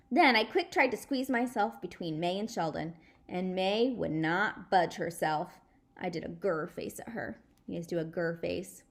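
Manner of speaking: usual speaking voice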